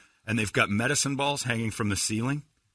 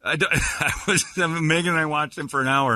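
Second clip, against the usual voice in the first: usual speaking voice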